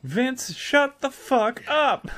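high loud mock yell; mock shout. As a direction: yell it at Vince in jest. Quoting movie line loud.

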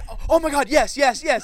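high, loud squealing yes, yes; thrilled fiancée shrieking her answer; ecstatic yes. Retold or imitated. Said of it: "she's like".

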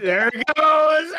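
gleeful yell as friend runs off; gleeful shout. Real, not acted. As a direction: cheer it out loud.

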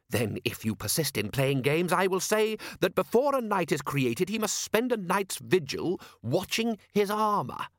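a hint of a stiff nobleman lecturing; haughty lord. A narrator's reading. Lord Rust lectures Vimes on knighthood, lightly haughty.

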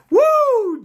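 high, loud joyful whoop; spontaneous cry of praise. Real, not acted. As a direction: let out a high happy whoop. Preacher overcome with joy.

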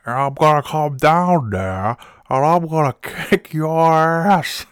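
put-on high, slow menacing old man growl; angry old man threat. Comedian reprising his angry old caller, slowly threatening. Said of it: "the angry old diecast cars guy".

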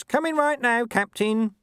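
very high-pitched call. A narrator's reading. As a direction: raise your voice to reach the next room. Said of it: "He raised his voice".

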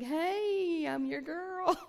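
eager shout of hey, pick me; joyful volunteering. Retold or imitated. Answering God like an eager donkey, loud and laughing.

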